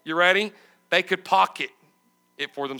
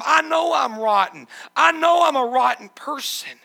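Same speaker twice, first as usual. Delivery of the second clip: loud, pleading cry of a broken man, higher and louder; desperate sinner's plea; 5 semitones higher, 5 dB louder than usual. Acted. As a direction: beg God with shame, crying out loud. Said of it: "And he says".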